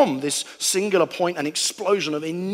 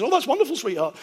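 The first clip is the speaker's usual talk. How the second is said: high, sweet put-on mother voice; a hammed-up mum praising her child; mum's voice; 8 semitones higher than usual. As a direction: coo it like a doting mum. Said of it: "my mum doesn't sound like that".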